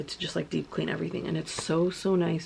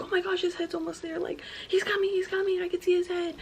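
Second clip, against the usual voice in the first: much higher, excited retold cheering; excited nurses; 13 semitones higher than usual. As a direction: squeal it like eager nurses. Retold nurses announcing the baby's head.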